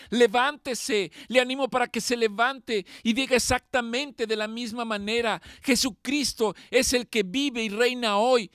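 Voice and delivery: loud, high, building fervent sermon; preacher to the congregation, rising to a passionate pitch; preaching at full force. Acted. Pastor urging the congregation, in a loud fervent preaching voice.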